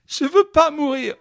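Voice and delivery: very high, loud wailing plea; child crying for help. Acted. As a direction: wail it like a terrified child. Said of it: "comme un enfant qui appelle au secours".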